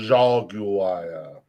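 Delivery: drawn-out pompous "Jaguar". Shown as a demonstration. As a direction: say "Jaguar" like a smug car ad. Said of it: "You gotta say it like a pompous asshole".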